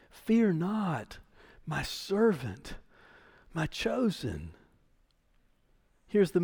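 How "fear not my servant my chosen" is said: soft and slow, speaking God's comfort; tender reassurance. Acted. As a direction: hush it gently, like a promise to a frightened servant. God's comfort quoted to Israel.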